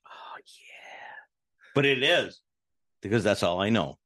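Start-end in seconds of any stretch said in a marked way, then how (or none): 0.1-1.7 breathy whispered oh yeah. Real, not acted. Whispered agreement.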